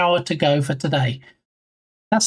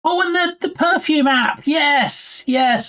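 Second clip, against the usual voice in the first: sudden recollection; 9 semitones higher than usual. Real, not acted. Suddenly remembering the app.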